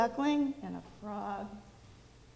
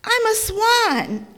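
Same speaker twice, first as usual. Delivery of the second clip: much higher, louder, joyful exclamation; happy cry; 11 semitones higher, 10 dB louder, slower than usual. Acted. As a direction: burst out with delight at the discovery. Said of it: "the ugly duckling cried happily".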